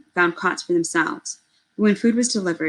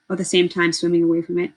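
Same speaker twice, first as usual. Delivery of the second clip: usual speaking voice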